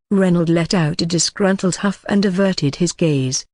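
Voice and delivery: ordinary delivery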